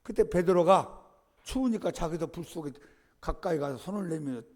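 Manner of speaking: normal talking voice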